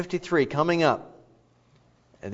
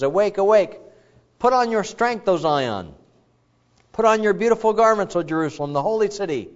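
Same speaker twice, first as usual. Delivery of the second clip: scripture proclamation; 6 semitones higher, 4 dB louder than usual. Acted. Reading scripture with force.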